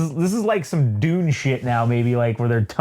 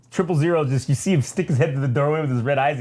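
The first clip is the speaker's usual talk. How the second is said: ordinary delivery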